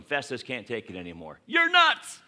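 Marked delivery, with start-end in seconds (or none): 1.5-2.2 very high, very loud outburst; furious yell. Acted. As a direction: scream it in his face. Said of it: "And he yells at him".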